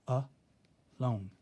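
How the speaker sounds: A, lone with a clear pause between the syllables; syllable split. Shown as a demonstration. Teacher splitting a word into syllables for the class. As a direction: split "A, lone" into two beats. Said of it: "It's how we pronounce it".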